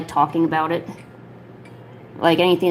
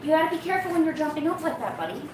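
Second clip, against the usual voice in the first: soothing pet talk; 7 semitones higher, 8 dB quieter than usual. Real, not acted. Owner soothing her cat after a fall, high soft cooing. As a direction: coo gently to calm the cat down. Settling the cat onto its chair.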